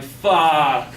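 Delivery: loud, drawn-out comic curse; exasperated outburst. Retold or imitated. Kid told they must pay, exasperated outburst. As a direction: let it burst out long and falling.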